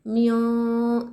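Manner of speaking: म्यों sung out with the nun hidden in the nose; teacher models nasalized म्यों; nasal म्यों. Shown as a demonstration. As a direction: hold म्यों through the nose. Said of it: "नून को हम नाक में छुपाएंगे".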